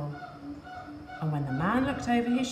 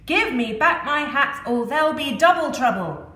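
loud, higher furious threat; 5 semitones higher, 8 dB louder than usual. Acted. Furious hat seller stamping at the monkeys, loud roaring. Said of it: "The man was furious".

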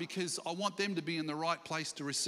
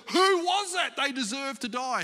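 high, loud burst of outrage, easing slightly; angry outburst; 6 semitones higher, 6 dB louder than usual. Acted. Preacher acting out King David hearing of the stolen sheep, angry outburst. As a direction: erupt in anger, demanding the culprit. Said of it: "he gets mad and he goes".

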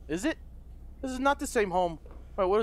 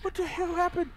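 dismayed; 10 semitones higher, slower than usual. Real, not acted. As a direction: react to a sudden failure. Mission failed, sudden blackout.

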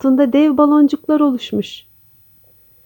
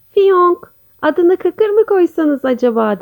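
lightly voiced frog, higher pitch; raised, questioning storybook character voice; character's suggestion; 6 semitones higher than usual. A narrator's reading. Frog suggests a name to the new parents, lightly playful.

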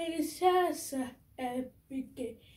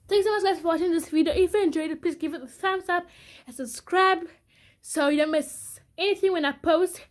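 much louder and higher, bubbly sign-off; excited outro; 4 semitones higher, 7 dB louder, faster than usual. Real, not acted. Thanking viewers and asking for subscribes, excited and loud. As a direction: beam at the camera and shout the goodbye.

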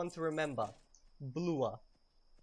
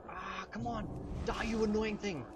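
gamer frustration; 7 semitones higher than usual. Real, not acted. Frustrated at game enemy.

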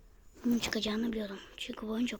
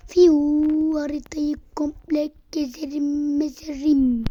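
gleeful whoosh; 5 semitones higher, 9 dB louder, slower than usual. Real, not acted. Flying excitedly.

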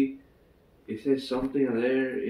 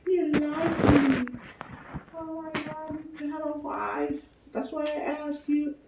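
very high, put-on girl's voice; 3 dB quieter than usual. Girl accusing him of lying, high mock voice. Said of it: "and the girl's like".

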